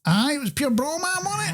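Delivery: thick mock-Glaswegian patter; Glaswegian impression. Man mimicking the in-law nobody understood, Glaswegian accent. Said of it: "sounded like he was from Glasgow".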